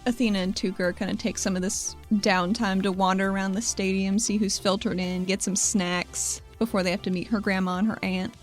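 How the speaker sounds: usual speaking voice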